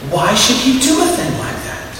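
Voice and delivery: put-on high, wide-eyed young angel, amazed and doubting; a young angel questioning his elder in disbelief, pitched high; incredulous young angel. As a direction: sound like a youngster who can't believe it. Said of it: "queried the younger one".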